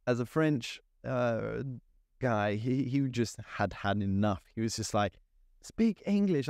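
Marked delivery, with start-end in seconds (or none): 5.6-6.5 a fed-up Frenchman losing patience; mock frustrated director. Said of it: "he just got really frustrated".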